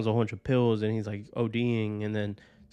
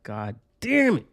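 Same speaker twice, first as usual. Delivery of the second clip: drawn-out, louder exclamation; exasperated; 3 semitones higher, 6 dB louder than usual. Retold or imitated. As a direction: stretch out an exasperated curse. Exasperated outburst at mishap, retold.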